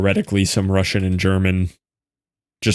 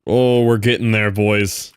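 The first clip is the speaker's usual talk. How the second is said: ordinary talk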